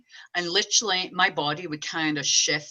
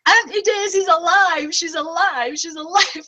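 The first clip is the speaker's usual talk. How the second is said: high, loud, slow exclaiming; a little girl shouting to her aunts; excited child shouting; 13 semitones higher, 10 dB louder, slower than usual. Retold or imitated. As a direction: burst in with the news. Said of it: "all my aunts are all sitting around".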